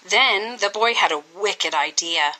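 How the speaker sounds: ordinary delivery, nothing marked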